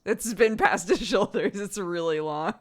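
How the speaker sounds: amused, laughing through the words. Real, not acted. Tells chat how long his hair is, laughing. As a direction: say it while breaking into a laugh.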